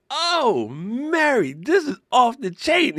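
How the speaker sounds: high, drawn-out exclamation; slow, hammed-up amazement; amazed reaction. Retold reaction to first pickleback shot.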